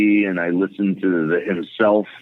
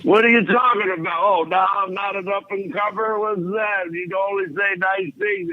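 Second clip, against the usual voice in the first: mock indignation; 13 semitones higher than usual. [name] mock-indignant at the announcer.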